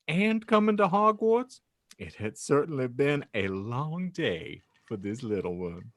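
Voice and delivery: ordinary delivery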